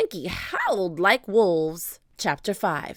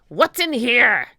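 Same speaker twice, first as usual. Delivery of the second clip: loud, slow demand; drawn-out, threatening question; pirate captain; 4 semitones higher, 9 dB louder than usual. A narrator's reading. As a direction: demand it loudly as a bullying pirate. Captain demands to see pack.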